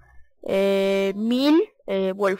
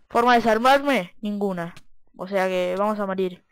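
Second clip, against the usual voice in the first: ordinary talk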